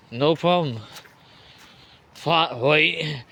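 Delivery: ordinary talk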